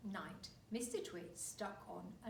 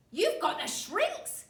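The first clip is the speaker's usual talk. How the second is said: put-on slow, loud, gloating old man; horrible grin; 9 semitones higher, 15 dB louder, slower than usual. Husband taunts wife.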